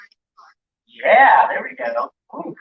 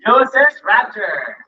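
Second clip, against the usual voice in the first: announcer roll call; 5 dB louder than usual. Acted. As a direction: call each ship name like a roll call. Announcing chapters on stage.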